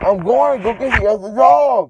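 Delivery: loud, high insistent shout. Real, not acted. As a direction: yell it back after being refused. Shouting to mom after no.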